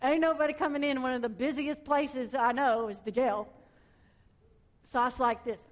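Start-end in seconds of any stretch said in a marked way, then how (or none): none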